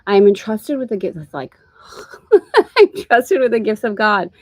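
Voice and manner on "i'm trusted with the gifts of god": louder, slower, words bursting out with laughter; laughing. Real, not acted. Teacher repeats the lesson line to students, laughing. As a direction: laugh as you declare it.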